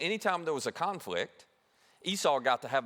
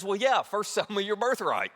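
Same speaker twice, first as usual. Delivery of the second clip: smug younger brother; 5 semitones higher, 3 dB louder than usual. Retold or imitated. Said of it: "as only a younger brother could".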